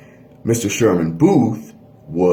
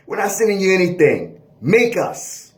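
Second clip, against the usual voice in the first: laughing taunt; 11 semitones higher than usual. Pretend. Court voiced taunting Supreme Court.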